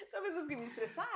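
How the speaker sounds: said through real laughter; laughing. Real, not acted. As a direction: laugh while admitting it. Laughing about stressful coworkers. (rindo enquanto fala)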